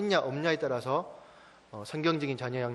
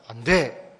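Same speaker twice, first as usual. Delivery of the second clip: loud, low, stern refusal; 4 semitones lower, 7 dB louder, much slower than usual. Retold or imitated. Father grips his daughter's hand and forbids her, sternly. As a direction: put your foot down, louder and lower. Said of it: "엄하게 얘기했어요".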